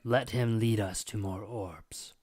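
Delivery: slow whispered demon voice. Acted. Demon whispering to the player, slow and coaxing. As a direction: coax him along in a whisper.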